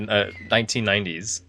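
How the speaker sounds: ordinary talk, nothing marked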